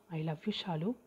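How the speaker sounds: soft, quiet, full of love; tender confession. A narrator's reading. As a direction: pour all his love into it softly. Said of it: "தன் மொத்த காதலையும் குரலில் தேக்கி". (மென்மையான காதல் குரலில்)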